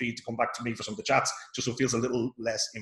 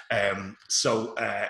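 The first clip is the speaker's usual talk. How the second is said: ordinary delivery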